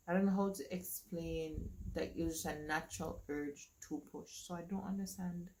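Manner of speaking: usual speaking voice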